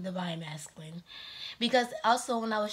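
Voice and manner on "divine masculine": whisper. Real, not acted. Whispered aside to the listener.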